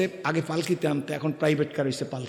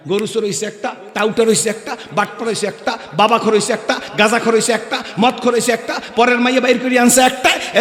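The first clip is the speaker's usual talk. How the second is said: rising list, getting higher and louder; building rant; 6 semitones higher, 7 dB louder than usual. Retold or imitated. Preacher listing what the son became, building to a shout. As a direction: pile up each insult louder and higher.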